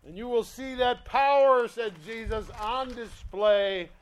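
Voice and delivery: loud, driving sermon voice; preacher pounding the message; preaching. Acted. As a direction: press the point home. Preaching at full force.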